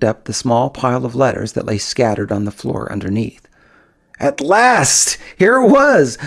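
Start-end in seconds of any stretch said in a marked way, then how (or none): 4.2-6.2 excited. Acted. Finally finding the letter.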